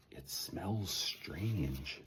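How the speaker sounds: slow, soft whisper; wary slow whisper while sniffing. Acted. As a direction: breathe it out slowly, barely voiced. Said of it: "he whispered".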